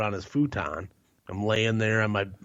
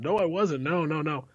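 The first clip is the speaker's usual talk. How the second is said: higher-pitched mock protest; protesting denial; 6 semitones higher than usual. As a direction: retell a caught drunk denying it, pitched up. Retold denial to the cop.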